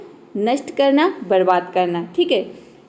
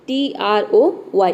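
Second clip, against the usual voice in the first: slow letter-by-letter "टी आर ओ वाई"; spelling out; 3 semitones lower, slower than usual. Shown as a demonstration. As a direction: spell it slowly for learners. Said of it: "इसको कैसे पढ़ेंगे". (धीरे-धीरे एक-एक अक्षर बोलें)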